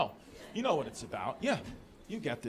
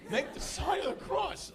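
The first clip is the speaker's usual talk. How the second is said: imitated yelling; 9 semitones higher than usual. Dad yelling at son at a red light by a church, imitated yelling. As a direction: scold like an exasperated old-school father. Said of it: "and he's yelling".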